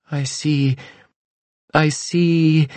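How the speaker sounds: high, entranced mystic voice; fortune teller. A narrator's reading. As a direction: murmur as if seeing visions.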